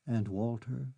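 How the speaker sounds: ordinary delivery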